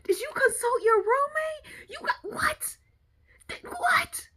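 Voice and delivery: high-pitched, sputtering questions; comic disbelief. Real, not acted. Questioning friend about the roommate, comic disbelief. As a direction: squeak it out, lost for words.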